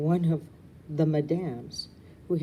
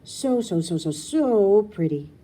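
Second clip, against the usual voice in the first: higher, louder, drawn-out swoon; swooning gush; 5 semitones higher, 8 dB louder, slower than usual. Acted. Rue swooning at the balcony view, drawn-out gush. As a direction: melt over how pretty it is. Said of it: "express myself the way Rue does".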